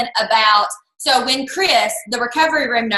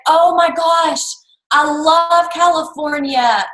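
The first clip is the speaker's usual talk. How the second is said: stretched-out, slightly raised gush; gushing enthusiasm; 4 semitones higher, slower than usual. Retold or imitated. Recruiter hearing about the move to California, gushing. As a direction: gush as if thrilled, lingering on the words. Retells her gushing reaction.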